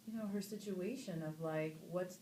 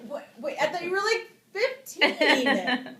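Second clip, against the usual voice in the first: much higher and louder, with the last word drawn out slowly; disbelief; 10 semitones higher, 17 dB louder, slower than usual. Retold or imitated. Actress telling her co-star she thought he was fifteen, high squealing disbelief. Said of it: "And then I was like".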